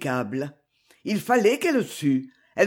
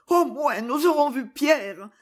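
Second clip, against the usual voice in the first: higher, drawn-out pleading; anguished plea; 5 semitones higher, slower than usual. A narrator's reading. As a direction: plead slowly in a raised pitch. Distraught mother pleading to go.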